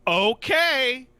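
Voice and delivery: exasperated. Real, not acted. Reacting to three-hour wait.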